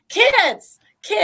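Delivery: loud exasperated call. Real, not acted. Mom scolding kids during live stream.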